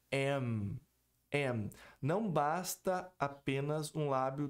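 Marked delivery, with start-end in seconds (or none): 0.1-1.7 M with lips pressed shut; demonstrating M. Shown as a demonstration. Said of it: "A pronúncia do M é".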